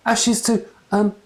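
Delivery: high squeaky nervous voice; bumbling priest squeaking. A narrator's reading. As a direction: squeak it like a flustered young priest. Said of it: "he squeaked".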